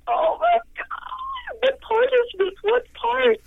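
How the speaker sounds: very high, slow, breathless wonder; overwhelmed excitement. Retold or imitated. Singer to producer, hearing the strings for the first time, squealing with excitement. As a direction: squeal it out in amazement.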